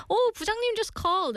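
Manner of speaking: high, hurried fake excuse; fake urgency. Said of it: "acted fake excuse".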